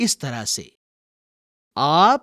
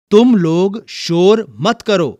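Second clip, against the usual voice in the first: raised, higher voice hushing the class; loud command; 4 semitones higher, 7 dB louder than usual. Shown as a demonstration. Teacher models telling the class off, loud and firm. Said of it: "अब मैं कुछ वाक्य बोलूंगा".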